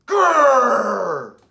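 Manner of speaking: a loud, rough growl; the sister roaring like a tiger. A narrator's reading. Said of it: "She roared like a tiger".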